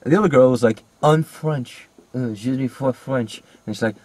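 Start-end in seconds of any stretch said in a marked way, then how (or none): 1.0-3.4 bad, slow French impression; badly faked French accent, slowing down; mock French accent. Imitating the other girl in the film, mock French. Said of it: "she put on a French accent".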